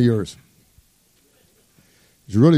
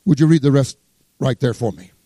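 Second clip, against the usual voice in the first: normal talking voice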